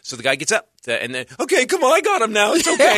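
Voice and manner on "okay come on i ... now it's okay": hammed-up calm-down; mock calming voice. Huge fan hauling heckler out, high mock soothing.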